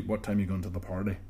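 practised Northern Irish accent; feed the line in a Northern Irish accent. Shown as a demonstration. Coaching co-host through a dialogue, Northern Irish accent. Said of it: "So we'll practice this".

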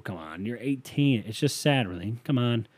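ordinary talk

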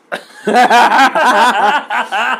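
big rising laugh burst; loud laughter. Real, not acted. Friends watching gross movie.

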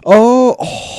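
loud, high-pitched "oh" of recognition; sudden realization. Real, not acted. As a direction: it suddenly clicks, voice leaps up. Sudden recall of who is meant.

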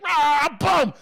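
loud, high "Ah, boom" like a slam dunk; mock triumphant shout. Commentators mocking a guest, shouting in triumph. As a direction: yell it like you just scored. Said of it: "they're just like".

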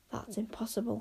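soft, disbelieving inner voice; hushed thought. A narrator's reading. Zara privately doubts the flying heart, hushed thought. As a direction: think it aloud, very softly. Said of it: "thought Zara".

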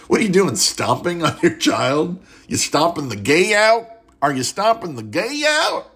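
rising to a high, incredulous question. Real, not acted. Mocking the meme's stomping parents.

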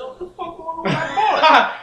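high, loud, laughing disbelief; outraged at the mystery charges; shocked outburst. Real, not acted. Discovering charges on his card.

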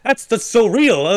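put-on high, loud panicked kid reaction; mock terror. Recalling childhood fear of living toys, mock terrified. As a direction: gasp it out like a spooked child. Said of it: "we were terrified".

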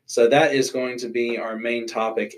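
ordinary delivery

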